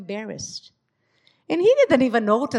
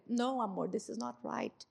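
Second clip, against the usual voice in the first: soft, calm, loving rebuke; soft and tender, not accusing; gentle correction; 8 dB quieter than usual. Shown as a demonstration. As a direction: correct him gently and kindly. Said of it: "you have all right to say".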